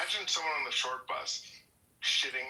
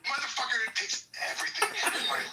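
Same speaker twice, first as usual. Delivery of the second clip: laughing through the rant; 8 semitones higher than usual. Real, not acted. Ranting while laughing.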